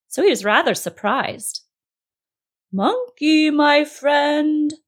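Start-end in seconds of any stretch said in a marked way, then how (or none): 2.7-4.7 high, loud sing-song call; mock-gentle calling. A narrator's reading. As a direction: coax from afar, as a falsely friendly crocodile. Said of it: "called the crocodile again".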